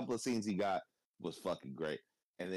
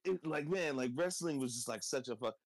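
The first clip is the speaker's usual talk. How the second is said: ordinary talk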